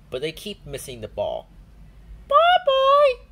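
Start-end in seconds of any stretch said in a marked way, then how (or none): 2.3-3.2 very loud high shout; shouting together. A narrator's reading. Peppa and Susie call George to fetch the ball, shouting. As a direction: yell for the ball boy. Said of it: "they shout together".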